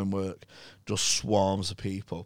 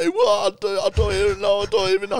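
higher, louder helpless protest; higher bewildered denial; flustered Mike; 11 semitones higher, 7 dB louder than usual. Retold or imitated. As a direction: sputter it out high and lost.

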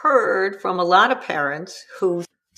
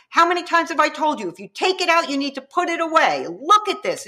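higher, fast nagging scold; scolding parent; 8 semitones higher, faster than usual. Shown as a demonstration. Parent attacking the child's character, fast angry nagging. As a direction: pile on the blame. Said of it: "attack the character of the child".

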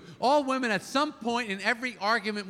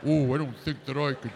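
low, dopey man impression; mocking man's voice; 8 semitones lower than usual. Wife to husband, mocking his low dopey voice. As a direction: play the whiny boyfriend, low. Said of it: "You say".

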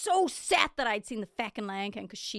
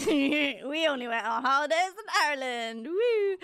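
high, drawn-out, louder mock cheer; mock celebration; 5 semitones higher, 5 dB louder, slower than usual. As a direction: slowly sing out fake delight, ending in a whoop. Mock celebration of poor childhood holidays.

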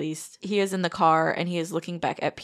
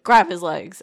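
sudden loud urgent command; urgent order; 10 dB louder than usual. Retold or imitated. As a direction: bark the order to grab him. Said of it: "he's like".

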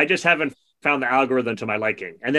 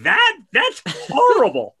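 much higher, slow, appalled squeal; mock horror; 13 semitones higher, 3 dB louder, slower than usual. Complaining about the algorithm, mock horrified squeal. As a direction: shriek in mock disgust at the suggestion. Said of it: "And I'm like".

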